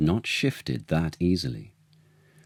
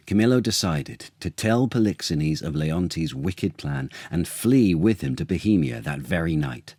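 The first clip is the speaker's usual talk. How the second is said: usual speaking voice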